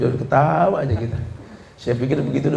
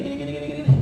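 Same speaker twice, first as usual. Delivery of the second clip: mocking nagging; 13 semitones higher, 4 dB quieter, much faster than usual.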